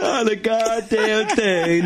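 high, very slow mock voice; mimicked punchline. Host repeating the guest's riddle punchline, high slow mimicry. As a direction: echo it high and stretched right out. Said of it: "and you go".

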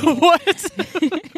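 high laughing squeak; tickled, incredulous giggle; laughing what. Real, not acted. Laughing at the joke.

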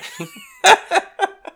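high-pitched loud laugh. Real, not acted. Laughs at own joke.

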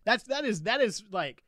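high, loud bragging hype; hyped up. Real, not acted. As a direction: celebrate like you just won. Celebrating the email.

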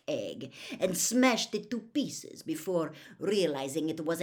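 loud, cold sarcasm; mock him with icy politeness; pointed, scornful telling, falling away at the end; cold mockery. A narrator's reading. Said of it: "said Karkaroff coldly".

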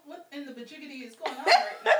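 loud playful exclamation; excited. Acted. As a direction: shout it with a laugh.

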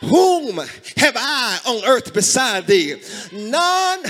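loud, drawn-out hymn recitation; fervent preaching. Real, not acted. Preacher reciting old church hymns to congregation, fervent. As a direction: cry the hymn lines out in bursts, long pauses between.

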